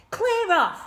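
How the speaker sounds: high, loud snap; indignant order. A narrator's reading. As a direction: order him out. Girl ordering boy out.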